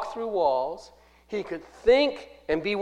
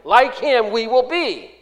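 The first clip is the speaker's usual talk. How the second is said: "Like him" raised and loud, then "we will be"; stress on LIKE HIM; 5 semitones higher, 4 dB louder than usual. Shown as a demonstration. Preacher shows congregation the emphasis is upon Jesus, emphatic. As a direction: lead hard on "Like him". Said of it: "The emphasis is upon Jesus".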